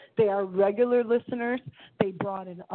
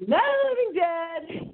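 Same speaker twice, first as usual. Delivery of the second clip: high dramatic title; 10 semitones higher than usual. Acted. Title said a third time.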